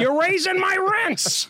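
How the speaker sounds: high, hammed-up Boston accent complaint; Boston local's outburst. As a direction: wail it like an aggrieved Southie local, Boston accent. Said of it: "They'd be like".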